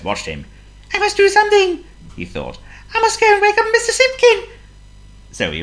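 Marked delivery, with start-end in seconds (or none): 0.9-1.8 very high, loud little mouse voice; squeaky mouse. A narrator's reading. John Mouse to himself watching the burglar, urgent squeak. 2.9-4.5 urgent, very high mouse voice, loud; squeaky mouse. A narrator's reading. John Mouse deciding to wake his owner, urgent squeak.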